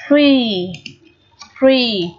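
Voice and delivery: free with the ee held, "freee"; long EE vowel. Shown as a demonstration. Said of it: "cũng là âm Y dài".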